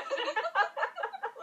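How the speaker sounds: rising laughter; high laugh. Real, not acted. (risa aguda)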